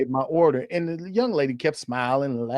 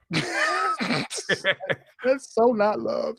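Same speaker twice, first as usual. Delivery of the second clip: drawn-out, laughing retort; 6 semitones higher, much slower than usual. Real, not acted. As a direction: stretch it out while laughing. Laughing rebuttal.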